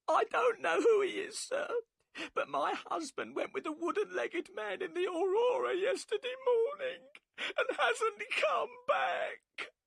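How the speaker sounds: high, loud crying, slowing into a sobbing cry; the wife wails for her missing husband; wailing in tears. A narrator's reading. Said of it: "and burst into tears".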